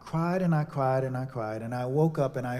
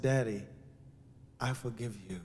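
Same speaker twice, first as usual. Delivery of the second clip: tender forgiveness; 4 semitones lower, 8 dB quieter than usual. Retold or imitated. Forgiving his father on phone.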